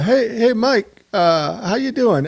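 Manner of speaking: a fond Navajo accent impression of an old radio friend; Harry Billy's Navajo accent. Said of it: "one thing that I did learn how to do is a, a Navajo accent, at least Terry Billy's accent".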